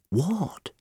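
high, soft, wounded query; hurt and baffled; pained. A narrator's reading. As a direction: protest it under your breath. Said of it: "he gave her a pained".

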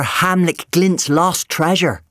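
high, loud, slow announcement; boyish, drawn-out revelation; excited reveal. Acted. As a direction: unveil the legend with relish. Boy excitedly reveals treasure legend.

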